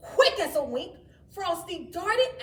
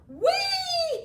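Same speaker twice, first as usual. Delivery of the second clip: high, loud, laughing whee; gleeful shout; 8 dB louder than usual. Acted. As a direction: squeal with joy while whizzing by. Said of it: "he shouted".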